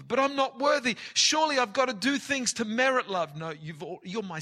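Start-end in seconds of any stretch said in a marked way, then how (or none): 0.0-3.4 high, loud pleading son's protest. Acted. Prodigal son begging his father, high and loud.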